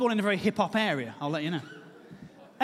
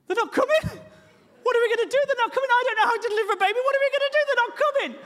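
high, loud frantic wailing; panicking; 13 semitones higher, 4 dB louder, faster than usual. Retold or imitated. Terrified husband telling his wife the midwives aren't coming. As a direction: fall apart in hammed-up panic. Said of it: "I'm absolutely panicking".

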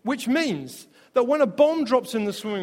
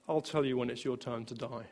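softer, lower, gentle and calm; the Father's voice; 8 semitones lower, 11 dB quieter than usual. Retold or imitated. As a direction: speak as a tender father reassuring. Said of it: "I heard the Father's voice".

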